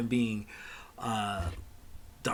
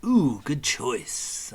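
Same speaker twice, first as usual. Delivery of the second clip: witch shopkeeper; 3 semitones higher, 6 dB louder, much slower than usual. Acted.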